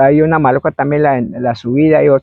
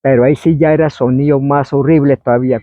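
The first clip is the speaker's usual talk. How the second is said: normal talking voice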